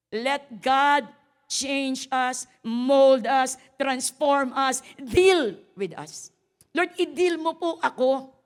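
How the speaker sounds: loud, slow preaching. Acted. Pastor urging the congregation to let God change them, loud. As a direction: plead it out loud, word by word.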